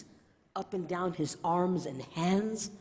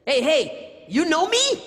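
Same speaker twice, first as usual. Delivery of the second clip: higher, louder eager call; imitating young man; 10 semitones higher, 8 dB louder, slower than usual. Stranger asking if she knows him, higher loud call. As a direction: lean in, loud and hopeful. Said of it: "then he said".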